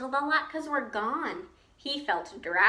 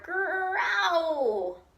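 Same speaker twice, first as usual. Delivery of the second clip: roar; 5 semitones higher than usual. A narrator's reading. Old Bear roars when his fur is tugged, a mild roar. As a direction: let out a playful storybook roar. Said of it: "Old Bear roared".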